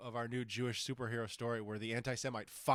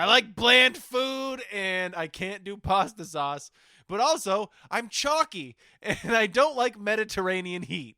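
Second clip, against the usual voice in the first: high, louder, slow whine; whiny high mock voice; 7 semitones higher, 8 dB louder, slower than usual. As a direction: whine it out slowly. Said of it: "where I'm just like".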